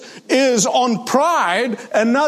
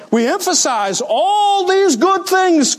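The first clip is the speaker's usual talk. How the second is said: higher, slow, mocking stretch on good things; sarcastic scare quotes; 7 semitones higher, slower than usual. Real, not acted. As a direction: lean on it with ironic distance. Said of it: "quote unquote".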